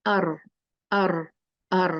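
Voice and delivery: अर with a long English-style r; wrong ar sound. Shown as a demonstration. Teacher demonstrating a mistake to students, wrong अर sound. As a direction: demo of the wrong अर. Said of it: "अर नहीं है ना".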